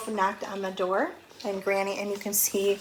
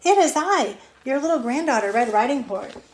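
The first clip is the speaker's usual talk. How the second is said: put-on little girl voice; 5 semitones higher, 6 dB louder than usual.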